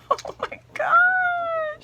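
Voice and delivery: very high, loud, drawn-out shriek; overwhelmed squeal. Real, not acted. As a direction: squeal it out slowly at the top of the voice. Overwhelmed by the gifted pieces.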